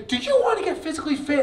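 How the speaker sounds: lightly voiced researcher. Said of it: "The researchers say".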